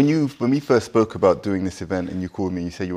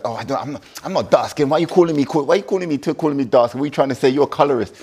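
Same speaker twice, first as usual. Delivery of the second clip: higher, louder mock offended outburst; mock indignation; 6 semitones higher, 4 dB louder than usual. Guest imagining snapping back at the host, mock offended. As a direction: act out being insulted, half in jest.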